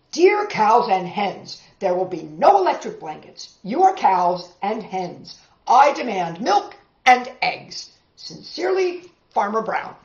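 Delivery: stern letter. Acted. Farmer's stern letter read.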